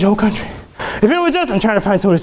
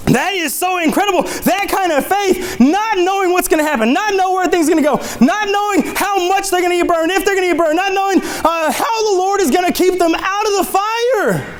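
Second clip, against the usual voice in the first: high, insistent preacher delivery; impassioned sermon, pitched high throughout; fervent preaching; 10 semitones higher than usual. Real, not acted. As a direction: pile up the phrases with mounting fervour. Preaches at full force.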